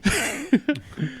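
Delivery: squealing little laugh; high squeal laugh. Real, not acted. Laughs at the game joke.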